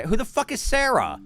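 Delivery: baffled outburst at the screen; incredulous shout. Real, not acted. Streamer yelling in disbelief at a name. Reacting to video text.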